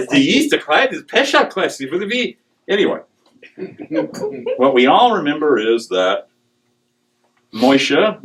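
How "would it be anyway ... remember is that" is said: ordinary talk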